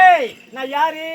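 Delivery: loud, commanding challenge; imperious queen. Acted. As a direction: demand recognition as the ruler. Queen demands to be acknowledged. (அதிகாரமாக உரக்கக் கேட்கும் மகாராணி)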